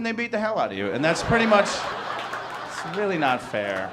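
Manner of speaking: ordinary delivery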